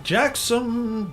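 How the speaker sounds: usual speaking voice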